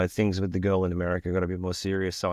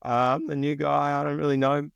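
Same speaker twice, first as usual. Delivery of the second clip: sheepish new guy; 6 semitones higher than usual. Retold or imitated. New guy answering the Portland crew, sheepish and unsure. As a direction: shrink into the awkward new guy. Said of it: "And I'm like".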